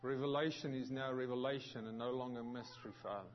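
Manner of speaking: hushed, reverent opening prayer. Acted. Pastor opens the service in prayer, hushed and reverent. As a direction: pray softly and humbly to the Lord. Pastor prays before the sermon.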